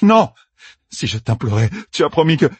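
high cry, urgent pleading after; desperate protest. Acted. Captive reminds torturer of her promise, desperately protesting. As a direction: protest frantically until cut off.

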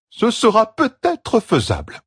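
high, slow, strange voice for the Aiel elder; Nadere lets it drop. A narrator's reading. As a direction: let it fall, slow and aloof. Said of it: "lâcha Nadere".

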